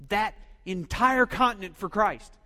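grand vow. Retold or imitated. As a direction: announce it like a bold mission. Friend's grand missionary vow.

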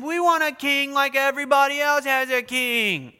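high, loud petulant demand; whining. Retold or imitated. Israel complaining to God, mock whining. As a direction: insist like a sulking child who wants what others have. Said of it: "started whining and complaining".

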